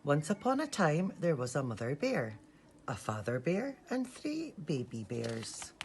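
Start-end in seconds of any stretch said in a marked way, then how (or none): none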